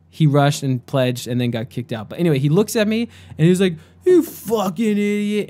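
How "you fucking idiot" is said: high, sneering jab; mock angry accusation; angry insult. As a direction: play the aggressive kid spitting the insult. Frat kid insults speaker.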